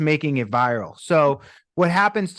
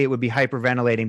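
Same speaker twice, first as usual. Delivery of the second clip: ordinary talk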